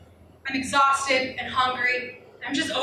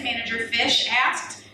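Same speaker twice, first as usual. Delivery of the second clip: ordinary talk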